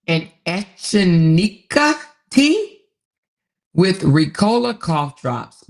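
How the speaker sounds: reading a comment aloud, loud and slow. Acted. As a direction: stretch each word out loudly.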